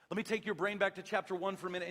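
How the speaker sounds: ordinary talk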